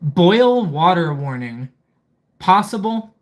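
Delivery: ordinary talk, nothing marked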